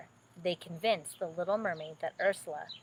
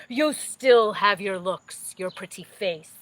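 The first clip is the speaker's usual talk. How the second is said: sea witch; 9 dB louder than usual. Acted. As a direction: sneer the compliment. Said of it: "replied Ursula".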